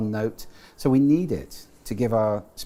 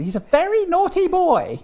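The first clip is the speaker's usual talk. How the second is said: put-on much higher, louder shrieking falsetto old woman; Monty Python falsetto; 8 dB louder than usual. To the host, quoting Monty Python: much higher falsetto dame. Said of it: "remind people of Monty Python".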